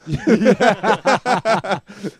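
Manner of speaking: loud, long real laugh. Real, not acted. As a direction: burst out laughing at being caught out. Teased about sizing up Theo.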